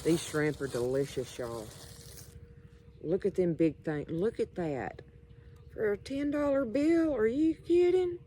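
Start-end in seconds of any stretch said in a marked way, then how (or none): 5.7-8.2 can't believe the bargain; higher, delighted disbelief; amazed. Real, not acted. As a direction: pitch jumps high in disbelief. Amazed at cheap shrimp price.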